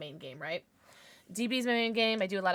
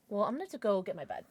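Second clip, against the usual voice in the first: ordinary delivery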